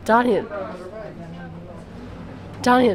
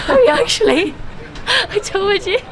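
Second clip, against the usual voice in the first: high laughing teasing; 10 semitones higher, 4 dB louder than usual. Real, not acted. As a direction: laugh while you tease. Laughing at cold friend.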